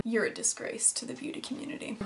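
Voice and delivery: soft, breathless self-mocking whisper; whispered aside. Real, not acted. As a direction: whisper a joke at yourself.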